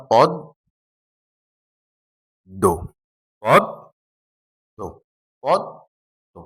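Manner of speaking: পদ দো said as two separate syllables, pausing between them; split into syllables. Shown as a demonstration. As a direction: spell out পদ, then দো, with a clear gap. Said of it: "তাহলে বললাম".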